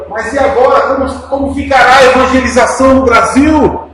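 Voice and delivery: loud, high-pitched cry of despair; acted-out desperate shouted plea. Sister pleading at the dying missionary's bedside, desperate. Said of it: "num tom de desespero".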